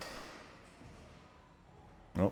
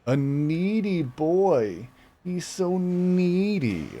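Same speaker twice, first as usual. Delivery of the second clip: very slow, stretched, high cooing; slow pet teasing; 9 semitones higher, much slower than usual. Real, not acted. Owner teasing his needy cat, slow stretched coo. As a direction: drag it out fondly at the cat.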